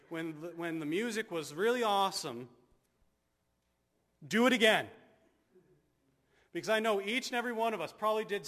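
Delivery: high, loud impassioned exhortation; preaching at full force. Acted. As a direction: drive the call to repent home.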